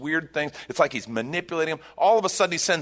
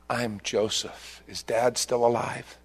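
tender reveal; slower than usual. Retold or imitated. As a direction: let the words land gently. Joseph's reveal retold tenderly.

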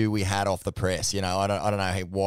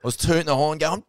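ordinary delivery

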